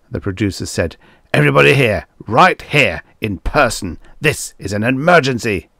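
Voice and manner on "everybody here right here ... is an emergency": loud, raised, emphatic orders; urgent. A narrator's reading. Producer summons his staff for an emergency, urgent. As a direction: hammer out the summons.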